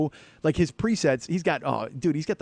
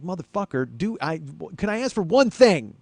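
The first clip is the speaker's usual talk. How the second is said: high exasperated plea, getting loud; exasperated outburst; 4 semitones higher, 3 dB louder than usual. Retold or imitated. Customer not wanting to seem rude inwardly explodes, exasperated outburst. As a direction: start pent-up, burst out on the last words. Inner plea about the loaner car.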